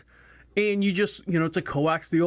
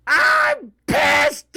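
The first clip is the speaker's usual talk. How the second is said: high, loud yell of pure frustration; furious shout; 8 dB louder than usual. Retold or imitated. Wife snapping at the TV setup, a furious high shout. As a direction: explode at the screen, voice way up and loud.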